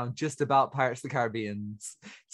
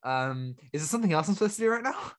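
mock cluelessness. Teasing the co-host who forgot his line, mock clueless. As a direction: imitate someone who has lost their place. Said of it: "You're like, "Oh, um".

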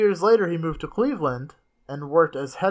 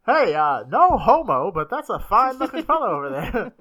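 put-on high old-timey voice through a laugh; vintage gent, laughing; 6 semitones higher, 8 dB louder than usual. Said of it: "the old-timey voice".

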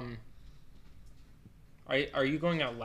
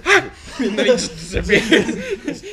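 laughing yelp; 10 semitones higher, 11 dB louder, slower than usual. Retold or imitated. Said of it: "He goes".

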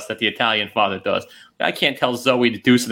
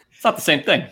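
ordinary delivery

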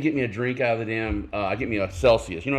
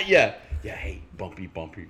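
ordinary talk, nothing marked

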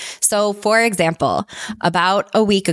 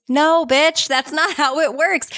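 sassy retort; 8 semitones higher, slower than usual. Acted.

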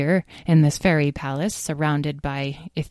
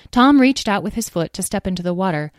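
ordinary delivery

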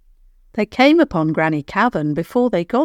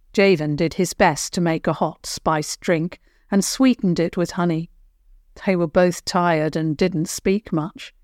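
plain reading voice

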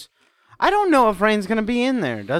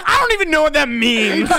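high laughing reply; laughing retort; 7 semitones higher than usual. Retold or imitated. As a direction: say it through a laugh. Retold own retort, laughing.

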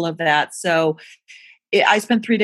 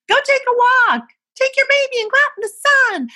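much higher and louder, a breezy mocking imitation of the doctor's office; mock pediatrician advice; 6 dB louder than usual. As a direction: parody the dismissive cheerful advice. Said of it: "The pediatrician's office would say".

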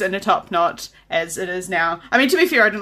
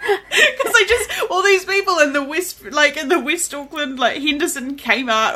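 ordinary delivery

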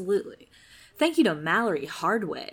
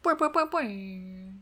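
springy boing noises; bouncy springy boingy voice. Pretend. Boing sounds.

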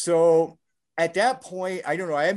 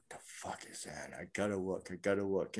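low, soft muttering to oneself; hushed alarm; 8 semitones lower, 12 dB quieter than usual. Retold or imitated. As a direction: keep it under your breath, very low. Inner reaction behind the door.

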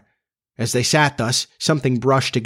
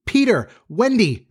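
shouting names; 10 semitones higher than usual. A narrator's reading. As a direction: call out for lost friends.

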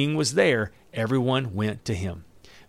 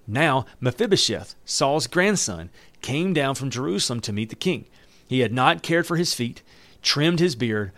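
normal talking voice

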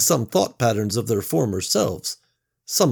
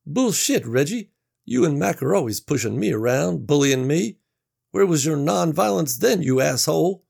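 higher, louder bitter accusation; angry Gary; 7 semitones higher, 5 dB louder than usual. Acted. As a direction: let the grudge out.

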